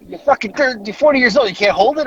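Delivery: high, exasperated mock dad; incredulous scolding. Dad's incredulous scolding voiced in story.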